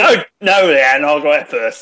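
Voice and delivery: slow put-on South London accent; lightly done South London voice; a hint of South London. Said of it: "I'll do a bloody South London".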